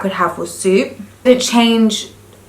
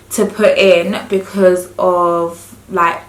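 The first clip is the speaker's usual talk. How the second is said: ordinary talk, nothing marked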